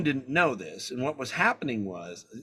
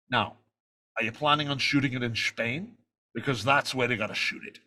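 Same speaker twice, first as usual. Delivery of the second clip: measured Scottish star impression; low, deliberate impersonation; Sean Connery; 4 semitones lower than usual. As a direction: imitate the veteran actor laying down terms.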